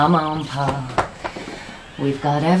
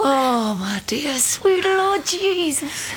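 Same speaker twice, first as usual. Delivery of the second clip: dismayed prayer; 6 semitones higher, slower than usual. Acted. Mother dismayed by the news.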